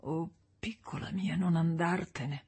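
hushed, pleading whisper; barely voiced, half-dreaming plea. A narrator's reading. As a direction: beg softly through cracked lips. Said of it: "sussurrò tra le labbra gonfie e screpolate".

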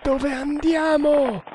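high, stretched-out questioning call; Nagor calling out. A narrator's reading. Nagor asking his companion on galloping horse, calling out. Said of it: "chiese Nagor".

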